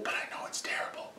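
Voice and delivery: conspiratorial stage whisper. Real, not acted. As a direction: lean in and whisper it. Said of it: "stage whisper aside".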